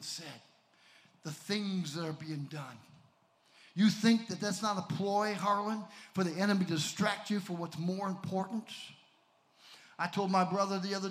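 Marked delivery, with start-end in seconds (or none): none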